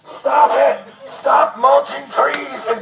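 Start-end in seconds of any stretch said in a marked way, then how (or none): none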